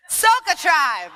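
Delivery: high, loud, slow shout of "Soca Tribe"; loud slow call. Shown as a demonstration. Dance leader calls to the room, slow loud shout. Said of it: "I'm gonna give you the call".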